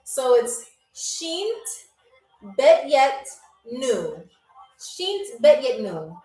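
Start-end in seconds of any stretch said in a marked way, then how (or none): 0.9-4.2 each word set apart by pauses; slow, spaced-out pronunciation demo. Shown as a demonstration. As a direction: say it carefully, word by word. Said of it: "I have to say this very, very slow".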